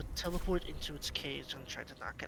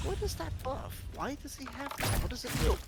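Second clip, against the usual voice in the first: confused questioning; 9 semitones higher, 3 dB louder than usual. Retold or imitated. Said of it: "I was like".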